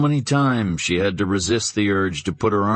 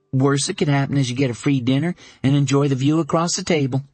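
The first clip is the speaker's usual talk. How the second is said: tossed out lightly; 7 semitones higher, faster than usual. A narrator's reading. Dana offhand advice.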